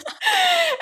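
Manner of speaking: a squealing laugh; high burst of laughter. Real, not acted. Reacting to her manager's mocking reply, squealing laughter.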